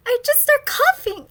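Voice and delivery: usual speaking voice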